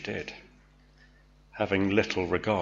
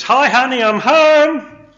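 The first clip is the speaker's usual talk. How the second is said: high, loud, beaming husband greeting; cheerful husband; 13 dB louder, slower than usual. Acted. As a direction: call it out cheerily through the door. Husband cheerfully arriving home, acted.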